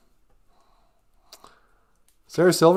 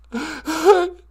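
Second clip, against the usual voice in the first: high mock laugh; 12 semitones higher, 4 dB louder than usual. Caller laughing.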